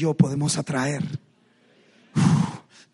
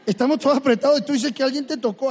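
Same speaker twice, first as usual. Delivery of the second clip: louder, higher incredulous mockery; half-mocking; 7 semitones higher, 8 dB louder, faster than usual. Peter to Jesus in the crush, half-mocking protest. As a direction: protest loudly, almost laughing at him. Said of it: "Pedro, casi burlándose".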